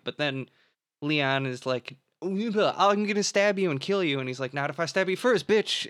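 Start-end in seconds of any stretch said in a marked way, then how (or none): 2.2-4.2 raised, lightly acted threat; a touch of movie menace; mock threat. Host retells Leon's threat to the prince, mock menace. Voices a threat in plot recap. 4.5-5.9 raised, punchy comeback; lightly acted triumphant retort; mock retort. Host retells the comeback before the stabbing, mock defiance. Voices a comeback in plot recap.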